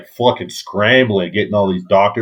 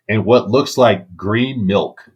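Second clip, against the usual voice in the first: ordinary talk, nothing marked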